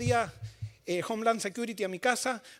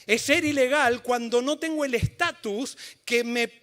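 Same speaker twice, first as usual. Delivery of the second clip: rising, louder, higher preaching; preaching at full force; 4 semitones higher, 6 dB louder than usual. Acted. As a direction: build the sermon to a passionate climax. Preaching climax.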